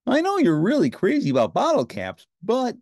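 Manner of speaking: high, bright Muppet Ernie voice; cheerful Ernie, pitched well up. A narrator's reading. Ernie answering Bert, in a high cheerful voice.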